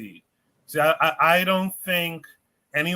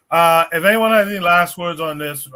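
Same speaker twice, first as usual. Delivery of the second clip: louder, calling across the room for last words; raised voice; 3 semitones higher, 9 dB louder than usual. Real, not acted. Host calls to the panel for last words, loud. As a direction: project it so the whole panel hears.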